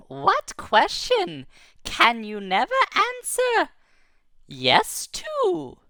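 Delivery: high, slow riddling voice; mysterious riddler. Acted. Glowing ball of light asking the boy its riddle. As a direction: pose the riddle like a sly spirit.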